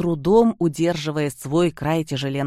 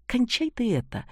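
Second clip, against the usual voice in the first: sharp rebuke; 8 semitones higher than usual. Acted. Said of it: "прикрикнул на него Антипов".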